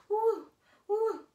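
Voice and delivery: high, loud gleeful whooping; roller coaster whoops. Pretend. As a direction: squeal like riding a roller coaster. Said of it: "Roller coaster, buckle in".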